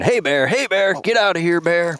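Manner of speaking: high mock yell at a bear; retold scream. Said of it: "we just started screaming".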